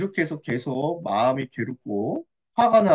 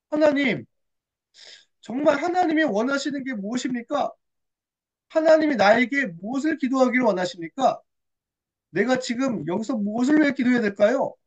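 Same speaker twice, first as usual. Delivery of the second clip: higher-pitched earnest appeal; questioning prayer; 5 semitones higher than usual. Acted. Hannah asks God what to pray for, earnest questioning. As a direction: plead to know what is wanted. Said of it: "하나님께 질문하기 시작했어요".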